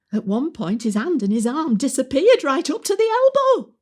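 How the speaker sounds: rising to a high, loud finish; scandalised climax. Acted. Resident recounting the fireman's inspection, rising in outrage. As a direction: build to an appalled comic punchline.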